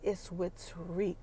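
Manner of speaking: low, soft and slow confession; quiet reveal. Retold or imitated. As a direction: let it drop low and slow. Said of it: "You know what Tasha says?".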